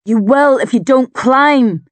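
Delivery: loud, high, slow forceful yell; bellow. A narrator's reading. Drust warning the panicking climber on the cliff, bellowing. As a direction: bellow each word as a warning. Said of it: "he bellows".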